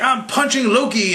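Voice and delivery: high-pitched hammed-up battle announcement; mock hero. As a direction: announce the punch like a silly superhero. Voicing Captain America mid-fight.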